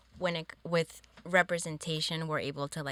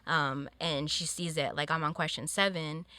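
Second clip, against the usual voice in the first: usual speaking voice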